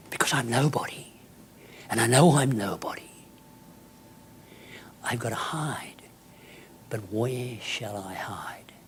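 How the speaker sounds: whispered, hushed confession; conspiratorial whisper. Retold or imitated. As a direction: whisper it like a secret plan. Secret hiding in boarding school.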